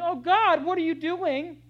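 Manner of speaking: high, loud cry of frustration; frustrated scream. Retold or imitated. Frustrated believer screaming at God, loud and high. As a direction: throw it at God, fed up. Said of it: "you start out screaming".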